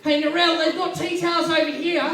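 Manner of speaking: very high mimicked Australian tourist call. Tourist calling to Narelle in souvenir shop, high mimicked call. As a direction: holler across a shop in a high voice. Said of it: "I heard this voice call out".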